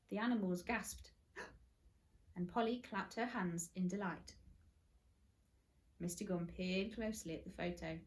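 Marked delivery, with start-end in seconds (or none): none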